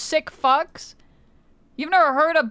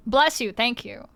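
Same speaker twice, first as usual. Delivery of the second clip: ordinary delivery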